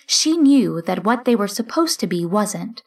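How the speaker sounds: ordinary delivery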